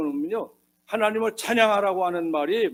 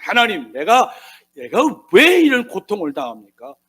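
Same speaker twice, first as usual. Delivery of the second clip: loud, higher-pitched imitation of Job protesting; protes Ayub; 4 semitones higher, 8 dB louder than usual. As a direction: plead a case to God, loudly, as Job. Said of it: "이렇게 항변하고".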